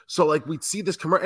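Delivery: ordinary talk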